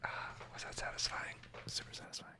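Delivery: soft breathy ASMR whispering. Acted. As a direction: whisper close to the mic.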